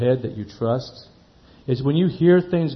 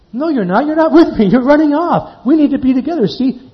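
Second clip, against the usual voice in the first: high, raised-voice telling-off; acted-out exasperated chaperone, pitched up; urgent scolding; 11 semitones higher, 6 dB louder than usual. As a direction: correct a straying child urgently. Retold scolding of straying children.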